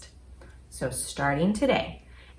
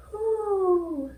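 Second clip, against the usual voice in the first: high hoot; 12 semitones higher than usual. Acted. Hidden voice asks from hole.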